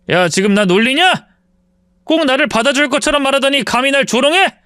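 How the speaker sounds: affronted, rising accusation; indignant anger. Acted. Ex-husband accusing ex-wife of mocking him, indignant. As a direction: demand to know if she is mocking you. Ex-husband rages after throwing helmet.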